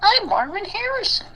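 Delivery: very high put-on squeaky-high character voice; Marvin Harrison voice. Friend asks for the voice, high silly impression. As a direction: greet in a silly falsetto persona. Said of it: "You should do the voice for Marvin Harrison".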